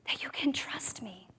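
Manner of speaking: unvoiced, hushed voice of God; soft whispered plea for trust. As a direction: whisper it gently, like a patient reminder. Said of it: "He said".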